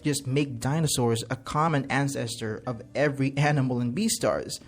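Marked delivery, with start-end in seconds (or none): none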